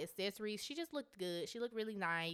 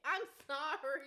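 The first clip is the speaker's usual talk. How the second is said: burping while talking; burping the words; 7 semitones higher than usual. Real, not acted. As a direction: talk through a burp. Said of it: "I keep burping".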